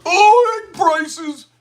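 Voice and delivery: high, loud mocking "oh, egg prices"; mocking sneer. Commentator mocking critics with a sneering high voice. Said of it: "they're like".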